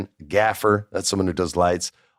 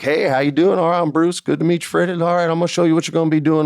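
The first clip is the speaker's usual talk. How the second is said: put-on Texas boy; 8 semitones higher, faster than usual. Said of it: "he's this good old Texas boy".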